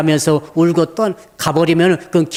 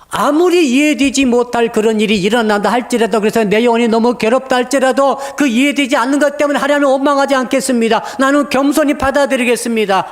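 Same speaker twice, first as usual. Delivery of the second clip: raised, higher sermon climax; preaching at full force; 7 semitones higher than usual. Acted. Pastor urging congregation to accept humbly, fervent preaching. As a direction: proclaim it to the congregation, lifting each line.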